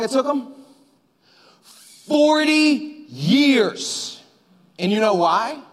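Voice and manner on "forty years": FORTY YEARS very slow, high and drawn out; stretched, high-pitched reveal on FORTY and YEARS; dramatic emphasis. Real, not acted. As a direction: land each word of "Forty years" as a punch. Punchline answer to how long.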